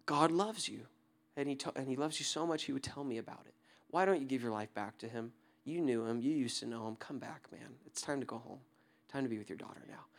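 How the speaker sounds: near-hushed, gentle invitation; soft tender plea. Retold or imitated. To the weeping stranger, hushed loving invitation. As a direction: speak love over him quietly. Praying over stranger on island.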